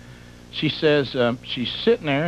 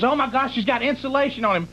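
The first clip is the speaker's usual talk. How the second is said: alarmed; 10 semitones higher than usual. Retold or imitated. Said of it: "she goes".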